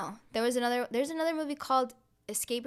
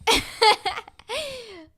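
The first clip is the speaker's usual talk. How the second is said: loud laugh; 13 semitones higher, 10 dB louder than usual. Real, not acted. Laughs after friend's quip.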